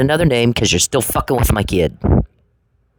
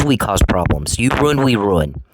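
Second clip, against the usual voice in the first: normal talking voice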